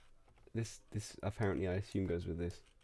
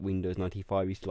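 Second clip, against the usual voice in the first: ordinary delivery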